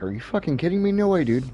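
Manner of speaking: "Are you fucking kidding me?" thrown up high in retold disbelief; half-joking incredulous outburst; mock disbelief. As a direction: replay the shock of being put in the pro division. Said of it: "And it was like".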